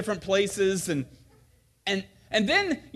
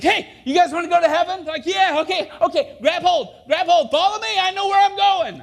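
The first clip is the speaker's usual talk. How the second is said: calling out; 13 semitones higher, 7 dB louder than usual. Retold or imitated. Blind guide leading campers.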